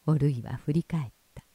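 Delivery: usual speaking voice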